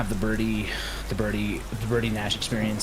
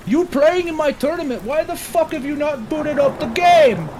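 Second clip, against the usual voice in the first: bad German accent, slow and high; 14 semitones higher, 5 dB louder than usual. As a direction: do a terrible German tournament organizer. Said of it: "I can hear [name]'s voice".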